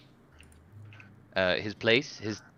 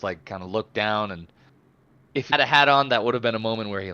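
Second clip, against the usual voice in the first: usual speaking voice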